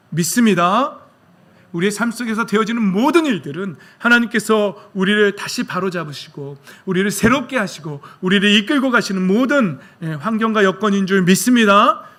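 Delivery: full-force preaching. Real, not acted. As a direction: preach it out to the whole congregation.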